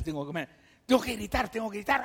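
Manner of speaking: fast, rising mock-frantic shout; frantic craving. Sick brain losing control, voiced.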